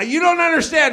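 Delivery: high-pitched acted pleading; insist, pitched well up; exasperated protest. Customer pleading his case to counter guy, high and exasperated.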